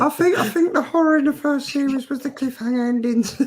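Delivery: very high squeaky character voice; put-on silly voice. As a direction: read it out in a high falsetto. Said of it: "do it in the voice".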